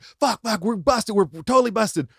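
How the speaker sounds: high, panicky we're busted; freaking out, caught; tripping friends panic near cops; panicked. Retold or imitated. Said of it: "We were like".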